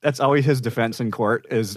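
normal talking voice

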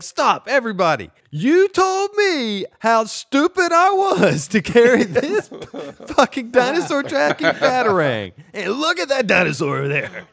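gloating Robin. Acted. Robin gloating to everyone about his batarang, high and laughing. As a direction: crow it out high and silly, told you so.

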